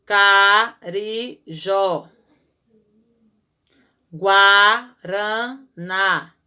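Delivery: "Carijó" very slow and loud, ca-ri-jó; syllable by syllable. Shown as a demonstration. Teacher sounds out the word to show the accent. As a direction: spell out "Carijó" slowly, stressing "jó". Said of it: "Toda vez que eu abro e fecho a boca".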